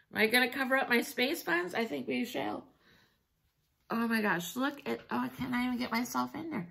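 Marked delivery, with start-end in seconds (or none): none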